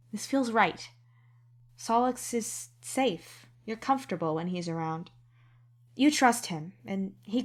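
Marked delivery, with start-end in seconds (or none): 0.1-3.4 higher, gently slowed inner voice; tender thought. A narrator's reading. Tender inner thought during hug.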